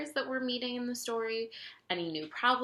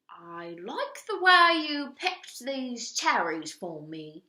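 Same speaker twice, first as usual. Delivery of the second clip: slow, higher, drawn-out duke voice; pleased duke; 5 semitones higher, 6 dB louder, much slower than usual. A narrator's reading. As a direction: savour each word, pleased. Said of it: "he said".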